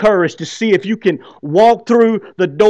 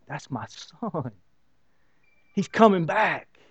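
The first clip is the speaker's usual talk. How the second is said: tender father; 7 dB quieter, slower than usual. Pretend. Father seeing son return.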